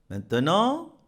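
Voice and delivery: ordinary delivery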